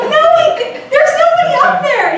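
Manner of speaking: high-pitched protest through laughter; incredulous protest. Retold or imitated. Retold protest to paranoid friend.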